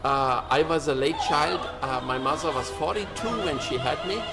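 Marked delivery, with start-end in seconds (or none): none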